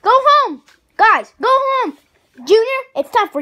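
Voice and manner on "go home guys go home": angry yelling. Acted. Dad yelling guests out.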